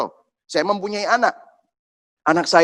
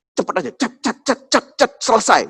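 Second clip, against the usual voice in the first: higher, staccato jab noises; rapid jab imitation; 5 semitones higher than usual. Doctor promising five quick injections, rapid staccato. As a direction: rattle off quick stabbing sounds.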